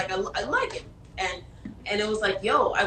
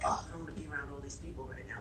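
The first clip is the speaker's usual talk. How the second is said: quick, low, much softer grumble; low fast mutter; 6 semitones lower, 14 dB quieter, faster than usual. Retold or imitated. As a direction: mumble it under your breath, reluctantly. Retold reluctant thought, muttered.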